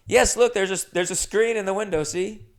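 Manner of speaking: a hint of a too-eager little liar; eager landlady. Landlady points out the screen to the inspector, lightly voiced eagerness.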